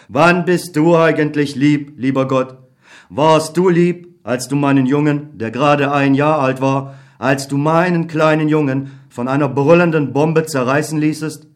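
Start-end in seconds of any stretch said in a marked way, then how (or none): none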